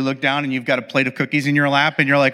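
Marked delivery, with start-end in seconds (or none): none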